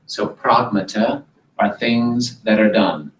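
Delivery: ordinary delivery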